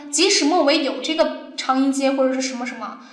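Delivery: usual speaking voice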